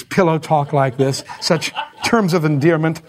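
ordinary talk, nothing marked

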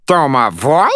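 high, loud outraged squeak; shrill furious. Acted. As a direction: shriek it indignantly. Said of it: "the little fairy shrilled, furious".

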